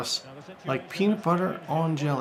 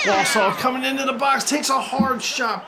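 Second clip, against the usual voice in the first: excited shout; 8 semitones higher than usual. Real, not acted. Commentator calling the opponent's counterattack, excited shout. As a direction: shout the attack as it comes in.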